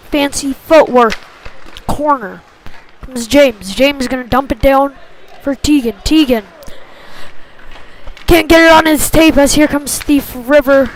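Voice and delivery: usual speaking voice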